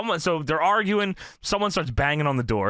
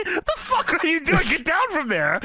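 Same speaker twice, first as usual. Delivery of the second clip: high-pitched, incredulous group quote; hammed-up disbelief through laughter; mock alarm; 13 semitones higher than usual. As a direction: act out baffled alarm, voice jumping high. Acted group reaction in retelling.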